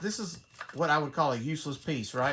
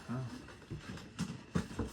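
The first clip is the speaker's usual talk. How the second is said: ordinary talk, nothing marked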